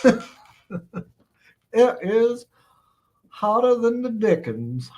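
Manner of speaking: hammed-up genteel Southern accent. Streamer joking as a plantation owner, Southern accent. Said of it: "I'll talk in a southern accent".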